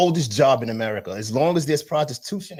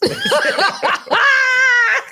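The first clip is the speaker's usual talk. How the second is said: a much higher, louder shrieking laugh; screaming laugh; 5 dB louder than usual. Real, not acted. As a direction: lose it completely in a shriek of laughter. Bursts out laughing.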